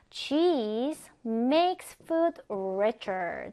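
slow, word-by-word English reading; slow model sentence. Shown as a demonstration. Teacher reads out an example about cheese, slow and deliberate. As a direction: stretch each word out for learners to follow.

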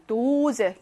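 first vowel of 도즈 drawn out; 도즈 with a long held o. Shown as a demonstration. Teacher reading a German example, long o in 도즈. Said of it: "길게 발음하고요".

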